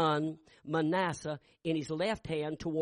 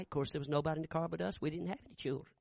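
ordinary talk, nothing marked

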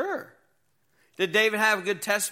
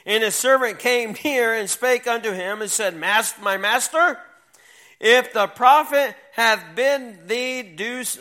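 scripture reading; 3 semitones higher, 6 dB louder than usual. Acted. Reads scripture aloud to youth.

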